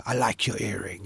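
a hint of a deep, lazy rock star. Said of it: "I thought his voice".

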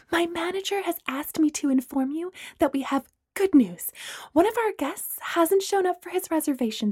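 discreet, apologetic service voice, half whispered; flight attendant voice. Acted. Attendant discreetly telling Gemma about her first-class upgrade.

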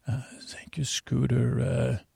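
hushed whispered thanks; breathy whisper in character; announcer whisper. Acted. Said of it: "This is our Hollywood announcer, m-, uh, Mister Antonio Banderas".

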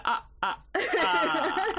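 Muppets vampire count laugh; a hint of Transylvanian vampire. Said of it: "the Muppets Count voice I keep doing".

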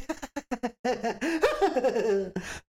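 high-pitched polite fake laugh. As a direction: giggle sweetly like on the phone. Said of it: "Like that".

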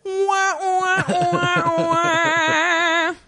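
high, drawn-out "wah" wail; mock wailing. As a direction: wail it in a held, sing-song high pitch. Mock wail at Daisy needing help.

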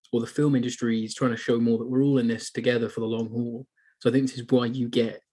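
normal talking voice

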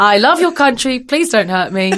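high, loud joking plea through laughter; mock pleading. Guest placating her Australian host, laughing mock plea. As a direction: pretend to beg, giggling.

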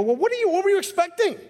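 high, incredulous what-did-you-expect; a pastor baffled at the frightened priest; exasperated pastor. Retold or imitated. Pastor to the startled priest, high and incredulous. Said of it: "as a pastor, I look at this and I go".

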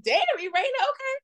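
high, impressed reaction; a high, impressed okay. Retold or imitated. Reacting to Irena's fresh face.

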